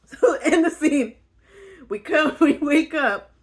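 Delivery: loud high laughing speech. Real, not acted. Vlogger restarting the scene while still laughing.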